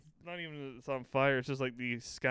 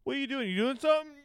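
much higher, squeaky nosy voice; high mocking neighbour; 13 semitones higher, 6 dB louder, faster than usual. Friends mimic nosy neighbours in a squeaky high voice.

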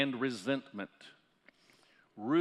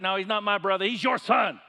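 angry brother; 6 semitones higher, 7 dB louder than usual. Retold or imitated. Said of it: "is yelling at him".

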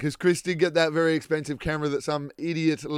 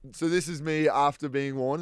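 ordinary talk

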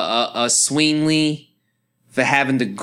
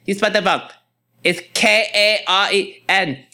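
higher-pitched, repetitive nagging correction; mimicked scolding customer; 7 semitones higher, faster than usual. Customer corrects cashier's spelling of her name, mimicked scolding. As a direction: imitate a customer insisting on the spelling. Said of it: "then, then she, she goes".

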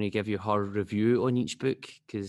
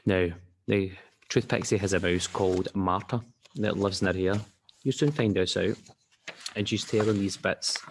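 ordinary delivery, nothing marked

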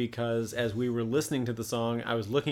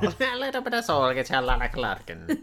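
laughing through a fake Irish lilt; hammy mock Irish, half-laughing; bad Irish accent; faster than usual. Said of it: "the worst Irish accent".

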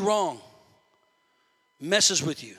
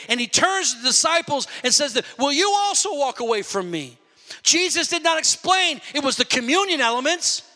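higher, ringing pulpit voice; preaching at full force; 7 semitones higher than usual. Acted. Preacher telling the church how Jesus was misunderstood, preaching hard. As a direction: climb into the sermon's peak.

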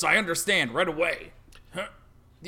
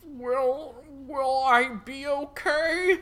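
higher, slow timid question; lightly voiced scared guard; 7 semitones higher, much slower than usual. The dying guard asks the priest if he'll live. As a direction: ask fearfully for reassurance.

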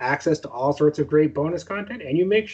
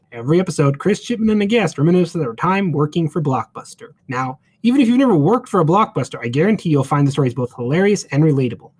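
ad read; 6 dB louder than usual. Acted. Host reads podcast ad.

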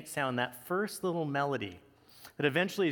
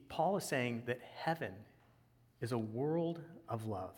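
hushed, low reverent delivery; solemn preaching; 5 semitones lower, 6 dB quieter than usual. Real, not acted. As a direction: let it sink in softly, with long pauses. Preacher drops to solemn reflection.

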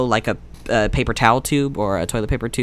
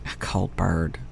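whispering; 4 semitones lower, 5 dB quieter, slower than usual. Real, not acted. Whispered echo.

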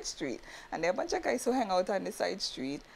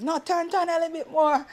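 street guy voice; 10 semitones higher than usual. Retold or imitated. Guy directing parking.

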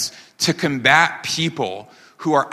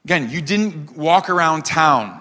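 ordinary talk, nothing marked

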